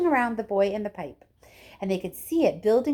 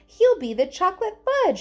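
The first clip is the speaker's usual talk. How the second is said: high, loud shriek; mother shrieking at Wonka; 10 semitones higher, 6 dB louder than usual. Acted. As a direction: shriek it in dismay. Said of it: "shrieked Missus Gloop".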